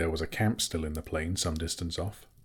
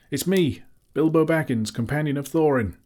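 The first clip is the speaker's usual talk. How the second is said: high, loud urgent cry; 8 semitones higher, 6 dB louder than usual. A narrator's reading. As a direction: cry out to be found. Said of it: "he cried".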